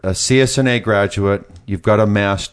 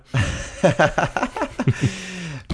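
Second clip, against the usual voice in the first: hearty laugh; a real burst of laughter; 7 semitones higher, 7 dB quieter than usual. Real, not acted. Host laughs after "that torture.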